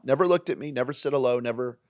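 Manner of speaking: ordinary talk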